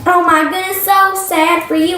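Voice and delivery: sing-song high "so sad for you"; lilting fake sympathy; mock pity. Mock pity over shirt.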